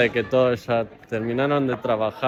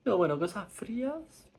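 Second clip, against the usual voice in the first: mock dentist voice; 10 semitones higher, 5 dB quieter than usual. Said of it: "te digan".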